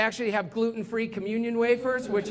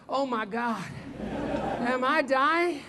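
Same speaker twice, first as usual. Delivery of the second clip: slow, drawn-out mock agony; hammed-up morning-after misery; acted suffering; slower than usual. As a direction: groan it like a wrecked partier. Acted partier suffering next day.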